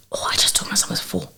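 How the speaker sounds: a hushed whisper while searching for an answer. Real, not acted. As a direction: whisper it as a private aside. Aside to herself while thinking.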